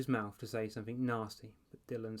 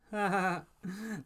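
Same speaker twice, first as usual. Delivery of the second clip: high, louder hammed-up ha ha ha; mocking laugh; 9 semitones higher, 5 dB louder, slower than usual. A narrator's reading. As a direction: laugh at a missed shot like a taunting kid. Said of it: "Ashley burst out laughing".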